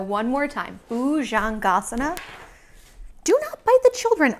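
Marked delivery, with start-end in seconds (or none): 3.2-4.3 high, loud playful scold; teacher scolding the shark; mock scolding. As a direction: tell off the shark.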